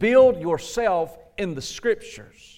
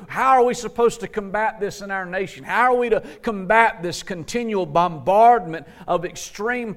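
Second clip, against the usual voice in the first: fervent preaching; 4 semitones higher than usual. Acted. Preacher to church, pressing urgent questions in fervent preaching. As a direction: press the congregation with question after question.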